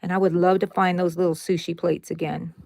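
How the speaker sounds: normal talking voice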